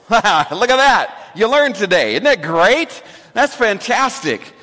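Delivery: high, loud, beaming praise for the room; excited praise. Real, not acted. Pastor cheers the congregation for memorizing the proverb, excited. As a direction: cheer them on for getting it right.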